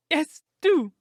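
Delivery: high, loud, anxious plea; pleading. A narrator's reading. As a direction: plead like a frightened little man. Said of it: "pleaded Thaddeus Sholto".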